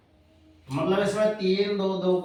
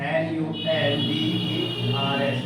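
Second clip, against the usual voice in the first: each letter of Indian said slowly and apart; slow spelling; much slower than usual. Shown as a demonstration. Teacher slowly spelling for the class. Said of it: "Indian number system".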